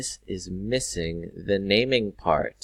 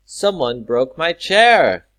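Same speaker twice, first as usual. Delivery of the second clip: loud, high acted outcry; exclaimed example; 7 semitones higher, 12 dB louder than usual. Shown as a demonstration. Teacher reads an example sentence, exclaimed. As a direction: call it out as a strong exclamation. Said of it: "Here are two examples".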